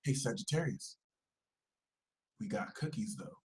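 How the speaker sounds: very soft, near-whispered lure; hushed, conspiratorial offer; sly tempter. Acted. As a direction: dangle the bait under your breath. Dark side tempting Sagittarius.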